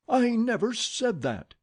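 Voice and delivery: high, loud firm declaration; declared. A narrator's reading. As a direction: declare it with wounded dignity. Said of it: "declared Madame Giry".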